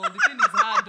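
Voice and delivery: high, loud laugh breaking through. Real, not acted. Admitting her accent won't change, laughing loudly.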